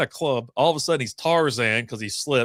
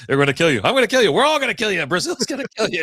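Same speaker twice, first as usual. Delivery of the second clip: higher and louder, frantic panic; panicked yelling; 8 semitones higher, 6 dB louder, faster than usual. Retold or imitated. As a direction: scream it like the mob is coming. Elijah panicking in Brazil.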